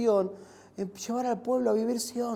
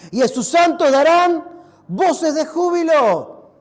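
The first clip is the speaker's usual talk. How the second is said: proclaimed aloud; 3 semitones higher, 9 dB louder, slower than usual. Shown as a demonstration. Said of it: "Para, no me escucharon".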